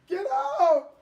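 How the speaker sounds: loud, high stammering yell; stuttering shout. Acted. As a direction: stammer it out in a panic. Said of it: "He was stuttering".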